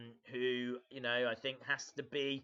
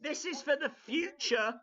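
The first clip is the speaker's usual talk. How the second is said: loud, raised-pitch match commentary; live play-by-play; 6 semitones higher, 6 dB louder than usual. Acted. Streamer calling a wrestling match for viewers, raised play-by-play voice. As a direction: call the moves like a wrestling announcer.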